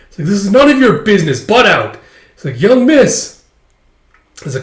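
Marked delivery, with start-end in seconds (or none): 0.3-2.0 high, loud telling-off; angry rebuff. Acted. Character snaps at intruder. 2.6-3.4 high, loud exclamation; alarmed maid. Acted. Maid cries out at child.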